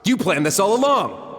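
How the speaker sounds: accusing. Acted. Realizing the trials were a scheme, accusing. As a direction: throw the realization at her.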